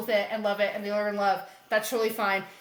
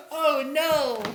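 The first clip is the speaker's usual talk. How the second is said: ordinary talk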